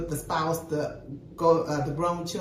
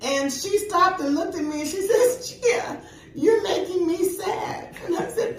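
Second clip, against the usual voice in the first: laughing retelling; 10 semitones higher, 3 dB louder than usual. Retold or imitated. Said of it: "acting like I was crying too".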